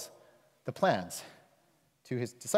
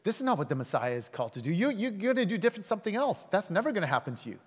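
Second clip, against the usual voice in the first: defending his honor; 7 semitones higher, faster than usual. Retold or imitated. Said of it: "He's defending Jesus' honor".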